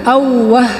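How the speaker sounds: ordinary delivery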